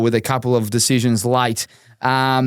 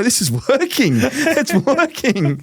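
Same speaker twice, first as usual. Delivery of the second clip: gleeful aside; 8 semitones higher, slower than usual. Retold or imitated. Delighted the face stops crying.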